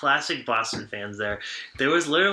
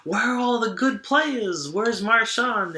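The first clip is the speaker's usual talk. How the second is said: higher, slow mock Boston-fan complaint; imitating a heckling fan; 9 semitones higher, slower than usual. Guest imitates the overtime heckler, higher and slow. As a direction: drag it out like a fed-up fan in the stands. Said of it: "he goes".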